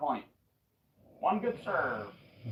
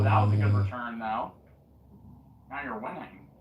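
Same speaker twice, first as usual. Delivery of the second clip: ordinary delivery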